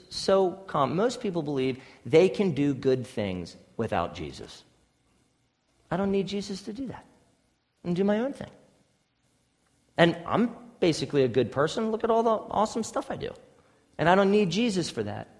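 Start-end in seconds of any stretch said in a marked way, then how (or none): 5.9-15.3 brushing off faith in a breezy imitated voice; mock self-reliant unbeliever. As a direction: lightly parody someone who says he needs no God.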